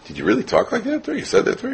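shocked disbelief. Retold or imitated. Parent shocked at son's behavior.

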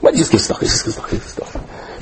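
fading into a casual whisper; hushed, careless repetition trailing away; offhand whisper. Acted. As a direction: toss it off, dwindling to a whisper. Mimics giving without any rules.

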